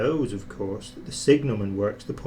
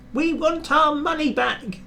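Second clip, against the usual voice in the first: much higher cross passengers' voice; crowd shouting. A narrator's reading. Passengers at the booking office, shouting. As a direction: demand it as an angry crowd. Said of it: "they shouted".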